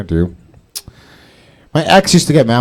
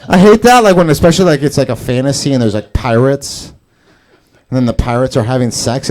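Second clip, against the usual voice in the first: normal talking voice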